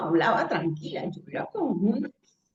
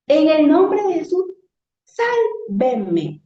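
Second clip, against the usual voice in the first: louder, higher commanding cry; forceful command; 7 semitones higher, 5 dB louder, much slower than usual. Retold or imitated. Mother commanding the flood in Jesus' name, forceful. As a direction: order the waters with full authority. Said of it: "les hablé así".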